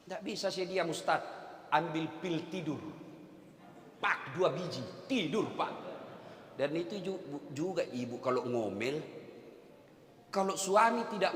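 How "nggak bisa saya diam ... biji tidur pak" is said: soft aside. Retold or imitated. Preacher mimics congregant's reply.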